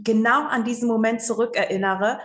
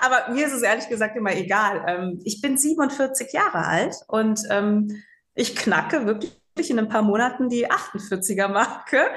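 usual speaking voice

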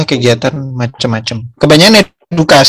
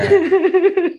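high laugh; 3 dB quieter than usual. Real, not acted. Laughing at the tree crash story.

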